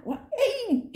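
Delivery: very high startled yelp; mock alarm. Narrator recalling castmate storming off, high startled yelp. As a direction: play a squeak of alarm.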